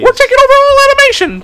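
cartoon mouse announcing conquest, squeaky; Mickey Mouse voice. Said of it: "Mickey Mouse".

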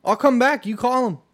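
high, loud, threatening rant; belligerent yelling. Retold or imitated. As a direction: act out a crazed man daring them to call cops. Aggressive stranger quoted.

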